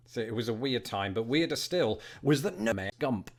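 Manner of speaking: ordinary talk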